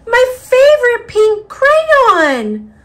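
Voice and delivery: high, loud, slow dismayed cry over a broken crayon; upset wail. Acted. Child wailing that her favorite crayon broke, high and slow. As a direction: wail it like a heartbroken little kid.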